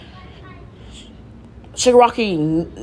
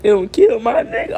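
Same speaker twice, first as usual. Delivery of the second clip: higher-pitched put-on weepy plea; badly faked crying on purpose; fake crying; 7 semitones higher than usual. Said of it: "I'm trying to cry on purpose".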